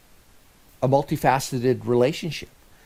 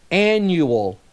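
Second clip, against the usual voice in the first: annual set apart, higher and drawn out; stress on annual; 4 semitones higher, 4 dB louder than usual. Shown as a demonstration. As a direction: lean firmly on annual between pauses. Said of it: "Stress the word annual".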